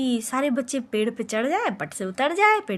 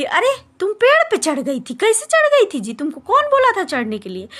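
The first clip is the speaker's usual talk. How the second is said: scolding sister; 7 semitones higher, 6 dB louder than usual. Retold or imitated. Elder sister scolds her.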